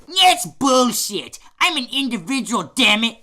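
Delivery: high-pitched, aggrieved objection; indignant protest. Acted. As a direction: protest hotly at being belittled. Bernard protests being a side character.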